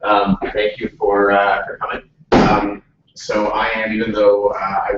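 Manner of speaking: projecting loudly to the room; loud Canadian voice. Real, not acted. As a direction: speak up so the whole room hears. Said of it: "I'm using my loud Canadian voice right here".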